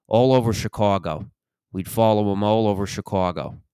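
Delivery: thick Chicago vowels on the city's name; old Chicago detective accent. Acted. Retired detective telling interviewer about a tail, Chicago accent. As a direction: play a retired Chicago cop. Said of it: "retired Chicago detective being interviewed".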